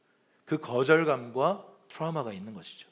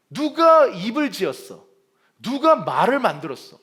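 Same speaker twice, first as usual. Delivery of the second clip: angry God; 8 semitones higher, 10 dB louder than usual. Retold or imitated. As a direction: thunder back at the excuse. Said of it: "막 화를 부럭 내시면서". (모세를 꾸짖는 하나님의 크고 높은 분노)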